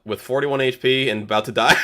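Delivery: ordinary delivery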